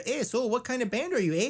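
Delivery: high mock Canadian accent, ending on eh; high, hammed-up Canadian guard asking about the band. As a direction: lampoon a Canadian border guard with a high lilt.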